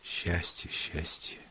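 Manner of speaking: soft, fading echo; hushed musing. A narrator's reading. Woman echoing her lover's word, softly musing. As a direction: repeat it quietly, almost to oneself.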